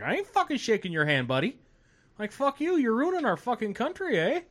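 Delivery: hammed-up cussing out with a Canadian eh; imitating the angry heckler. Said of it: "He's like".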